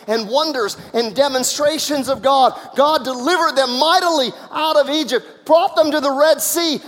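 high, loud preacher's proclamation; full-force preaching. Real, not acted. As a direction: proclaim it mightily to the congregation.

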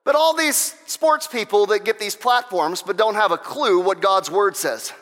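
loud, high, driving sermon voice; preaching at full force. Acted. Preacher rousing the congregation, loud and fervent. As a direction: pound it out like a revival preacher.

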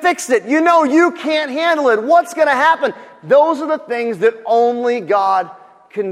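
high, loud preacher's delivery; full-force preaching. Real, not acted.

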